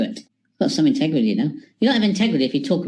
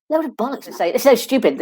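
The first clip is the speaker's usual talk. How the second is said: normal talking voice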